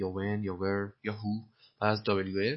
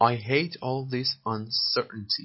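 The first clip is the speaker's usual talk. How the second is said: slow repeat; 3 semitones higher, 3 dB louder, much slower than usual. Shown as a demonstration. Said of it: "slow model reading".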